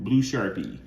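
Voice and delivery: ordinary delivery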